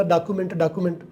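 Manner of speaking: "ڈاکیومنٹ" as daa-ku-ment; desi pronunciation. Shown as a demonstration. Teacher imitates desi speakers, "ڈاکیومنٹ" with "ku". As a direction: say "ڈاکیومنٹ" with "ku", no glide. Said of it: "سو مرتبہ دیسی لوگوں کو سنا".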